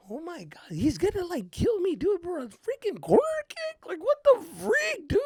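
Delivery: high-pitched frightened fretting; scared. Retold or imitated. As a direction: panic to yourself in a high little voice. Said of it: "I was so scared".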